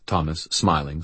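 ordinary delivery

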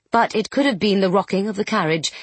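plain reading voice

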